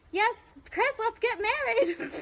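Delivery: gushing yes to a fan's joke proposal; mock proposal acceptance. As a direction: squeal a joking yes to a marriage proposal. Jokingly accepting a chat proposal.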